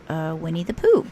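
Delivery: usual speaking voice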